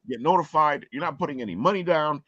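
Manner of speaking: ordinary delivery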